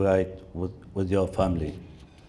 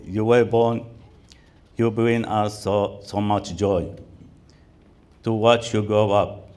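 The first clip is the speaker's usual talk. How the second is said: ordinary delivery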